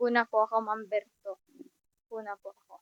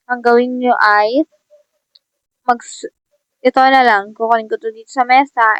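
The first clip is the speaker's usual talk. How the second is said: new teacher addressing class; 9 dB louder than usual. Acted. Plays teacher introducing himself.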